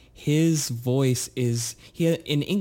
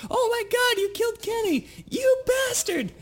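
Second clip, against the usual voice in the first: very high squeaky cartoon shout; South Park kid shout. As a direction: shriek it like an outraged cartoon kid. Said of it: "the joke that everyone said all the time".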